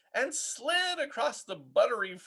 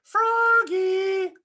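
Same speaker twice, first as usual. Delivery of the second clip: high, loud call of the name; 7 dB louder than usual. A narrator's reading. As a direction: call him from the next room. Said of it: "called his mother".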